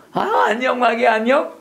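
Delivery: high, sing-song hello to the devil; playful pretend-friendly hello, pitched high; mock friendly greeting. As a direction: greet the enemy with put-on sweetness.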